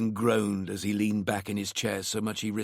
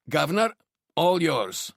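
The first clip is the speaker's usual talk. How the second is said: raised Glaswegian handover; Glaswegian tinged with London; 6 semitones higher, 4 dB louder than usual. A narrator's reading. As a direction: hand over in a Glaswegian-London accent. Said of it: "in his Glaswegian tinged with London accent".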